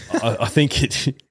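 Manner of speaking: ordinary delivery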